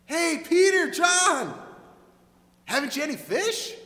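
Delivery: loud hailing shout from the shore; calling out. Acted. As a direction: call out to friends in a boat far off. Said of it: "He called out to them".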